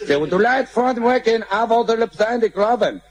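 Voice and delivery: slow, high garbled English; mock gringo English. American shouting down nonsense English, slow and mock. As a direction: mangle it like a clueless gringo.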